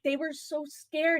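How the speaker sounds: ordinary talk, nothing marked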